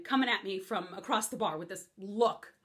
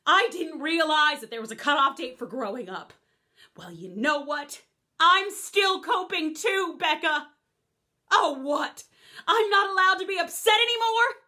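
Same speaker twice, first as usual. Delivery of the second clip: loud, higher, indignant push back; angry raised voice; heated argument; 5 semitones higher, 7 dB louder than usual. Acted. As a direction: defend your grief to Becca.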